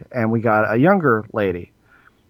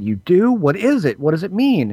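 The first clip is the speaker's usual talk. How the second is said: put-on excited child; 7 semitones higher than usual. Child eagerly asks counselor.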